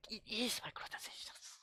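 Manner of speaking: whispered, high-strained insistence; exasperated whisper; hushed exasperation. Real, not acted. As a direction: hiss it under your breath in disbelief. Exasperated aside correcting Randy's claim.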